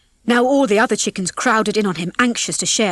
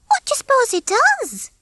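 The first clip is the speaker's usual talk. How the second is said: very high-pitched, simple hen voice; naive hen Babs. A narrator's reading. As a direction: muse in a high little voice.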